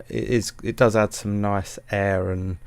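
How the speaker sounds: normal talking voice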